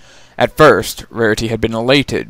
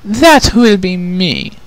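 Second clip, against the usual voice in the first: high, slow, louder proud princess; bold declaration; 9 semitones higher, 6 dB louder, slower than usual. Acted. Princess volunteering to her mother, proud and slow. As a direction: claim it before anyone else. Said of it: "Princess Chrysalis declared".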